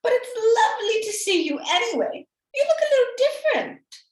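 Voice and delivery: put-on high, loud little girl's voice; wide-eyed girl, high and loud. Girl talking to disguised wolf.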